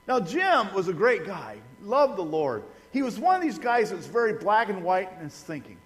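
ordinary talk, nothing marked

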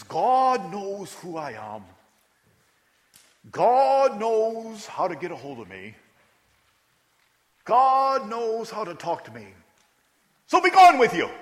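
slow, high, loud Bermudian pronouncement building to a squeal; impersonating Burton with grand, drawn-out dismissal; Bermudian accent. Retold or imitated. Burton sends the kid away in a slow, high Bermudian accent. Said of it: "Is that a good accent".

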